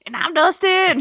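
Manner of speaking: high, hammed-up catchphrase; mock Dustin sign-off. Co-host echoing the Dustin bit, high and silly.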